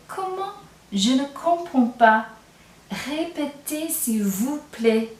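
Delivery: puzzled, slow plea to repeat, high on "Comment?"; confused learner. Shown as a demonstration. Learner who didn't understand asks the speaker politely to repeat. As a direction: act lost and ask them to say it again. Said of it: "I'm asking them to répéter".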